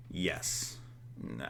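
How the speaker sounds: usual speaking voice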